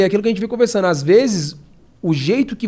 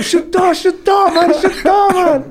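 high, loud excited shouts; re-enacted screaming; 13 semitones higher, 8 dB louder, slower than usual. Fighter acting out his sudden yell on the stretcher, screaming.

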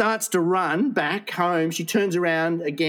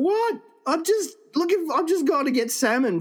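high-pitched, incredulous protest; acted exasperation; 11 semitones higher than usual. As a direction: act out baffled self-justification. Retold exasperation after the rescue.

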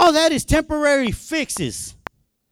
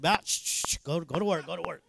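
soft, low shushing, repeated; shush, then murmur the order, fading away; hushing; 7 semitones lower, 13 dB quieter than usual. Retold or imitated. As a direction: imitate a quiet inner voice checking him. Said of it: "because the Holy Spirit said".

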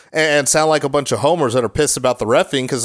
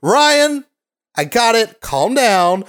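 raised, high-pitched scolding; imitated exasperated wife; 7 semitones higher, much slower than usual. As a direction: shut the husband down from the next room. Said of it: "And then I hear".

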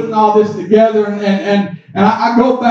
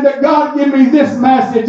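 ordinary talk, nothing marked

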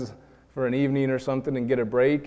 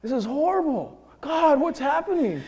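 much higher, strained and half-laughing in disbelief; retold panic, pitched way up with a nervous laugh; panicked self-talk; 10 semitones higher than usual. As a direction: replay the alarm of catching yourself in a rage. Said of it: "And I said to myself".

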